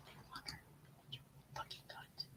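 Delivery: whispered grumble under the breath; muttered curse. Real, not acted. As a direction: whisper it to yourself, barely audible. Cursing at a slow computer.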